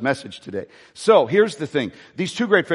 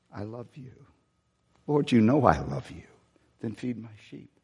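very soft, lower, choked with imagined tears; hushed tearful answer; 4 semitones lower, 7 dB quieter than usual. Acted. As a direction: whisper it as if near crying.

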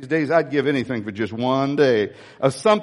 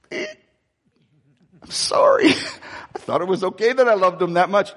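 sheepish apology; 3 semitones higher than usual. Retold or imitated. Pastor sheepishly apologizing to God, laughing. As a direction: laugh it off, embarrassed. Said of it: "I'm sitting there going".

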